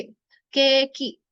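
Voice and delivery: केकी with the के held very long and slow; केकी spoken at a crawl to show the long vowel; stretched keeki. Shown as a demonstration. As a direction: drag out के before की. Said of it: "के की sound stretch हो गई".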